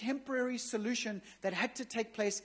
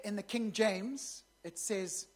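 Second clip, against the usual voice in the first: ordinary talk, nothing marked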